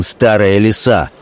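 usual speaking voice

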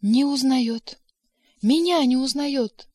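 mildly sobbing disbelief, drawn out; sobbing outburst. A narrator's reading. Secretary complaining that her boss doesn't know her, sobbing. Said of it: "взрыдала секретарь".

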